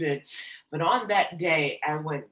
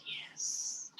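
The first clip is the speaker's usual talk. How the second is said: barely voiced whisper; whispered yes; 13 dB quieter than usual. Brother confirming mother died, whispered. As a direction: let it fall out as a breath.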